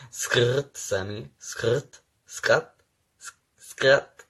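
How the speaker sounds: loud playful skrrt skid sounds, rising; skrt noises. Pretend. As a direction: yell skrt like tires screeching. Playful car-skid noises at Sammy.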